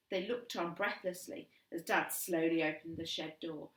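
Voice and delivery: ordinary delivery, nothing marked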